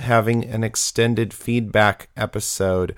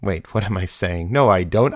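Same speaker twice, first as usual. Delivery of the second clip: usual speaking voice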